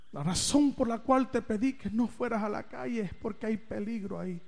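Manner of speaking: soft, low, tender fatherly voice; a calm dad explaining with love; loving father. Retold or imitated. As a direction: reassure your son gently. Father speaks lovingly after.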